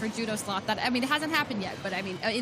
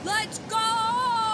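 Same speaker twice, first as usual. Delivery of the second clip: excited cheer; 8 semitones higher than usual. Real, not acted. Cheering the attack.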